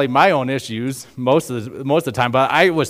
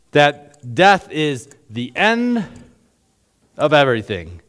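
ordinary talk, nothing marked

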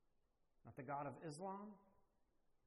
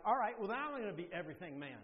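fast, louder half-joking exasperation; mock frustrated; 3 semitones higher, 7 dB louder, faster than usual. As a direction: hurry it out like a fed-up dad grabbing the last card. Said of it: "I'm getting really frustrated at this point".

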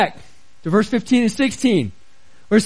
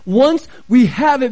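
"once we have it" punched, strongest on "once"; stress on "once we have it"; 2 semitones higher, 3 dB louder than usual. Real, not acted. Pastor points back to his wording, stressing "once we have it". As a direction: hit "once" hard in "once we have it". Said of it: "I emphasize the words".

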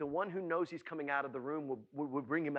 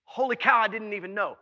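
loud, higher burst of amazement; thrilled astonishment; 5 semitones higher, 9 dB louder than usual. Acted. Preacher acting Mary learning of her cousin's baby, thrilled astonishment. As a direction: exclaim it as sudden happy news. Mary's sudden thrilled realisation acted out.

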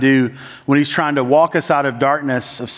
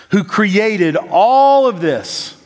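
awed emphasis; 5 semitones higher, much slower than usual. Acted. Awe at God's creation.

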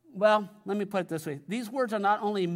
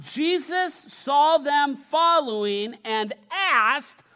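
slow, raised scripture reading; 4 semitones higher, 5 dB louder, slower than usual. Acted. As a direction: read the Gospel to the congregation, slow and lifted. Reads scripture aloud to congregation.

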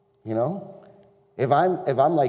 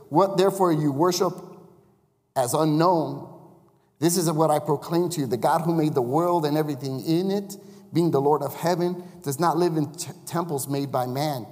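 louder, declaiming Bible reading; scripture reading; 3 semitones higher, 5 dB louder than usual. Acted. Preacher reading Acts aloud to the congregation. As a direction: proclaim the verse to the room.

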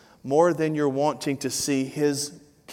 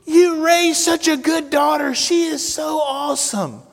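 much higher, louder mock cheer of cruise ladies; gushing ladies yelling; 13 semitones higher, 6 dB louder than usual. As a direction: belt it like delighted strangers calling out. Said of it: "And the ladies would yell".

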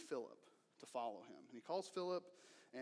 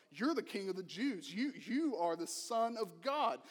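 louder, higher amazed declaration; 5 semitones higher, 8 dB louder than usual. Retold or imitated. Nathanael declares to Jesus who he is, amazed. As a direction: proclaim it in awe.

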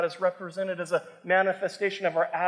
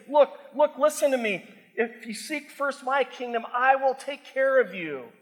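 raised, urgent call to listen; loud pleading voice of God; 5 semitones higher, 3 dB louder than usual. Retold or imitated. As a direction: preach God's words with rising force. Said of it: "God is saying".

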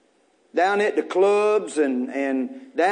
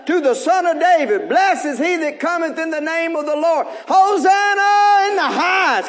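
higher, louder Hosanna shout; joyful crowd cry; 6 semitones higher, 5 dB louder than usual. Acted. Crowd shouting praise to the coming king, loud and joyful.

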